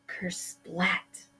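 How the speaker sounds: ordinary delivery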